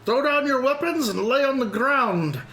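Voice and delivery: high, slow guard's bellow; dopey cartoon bellow. Guard captain's orders.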